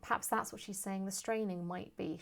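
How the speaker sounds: ordinary delivery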